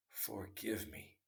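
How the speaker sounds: a faint, slow plea for forgiveness; weak voice. Acted. Wounded enemy begging the king's pardon, weak and slow. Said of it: "in a weak voice".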